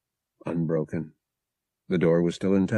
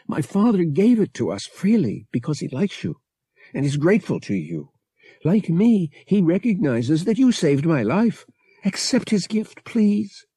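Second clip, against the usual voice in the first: put-on higher, tender voice of a young woman saying goodbye; Bria reading her letter; 4 semitones higher than usual. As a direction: read it as Bria, pleading and loving. Bria's letter read aloud.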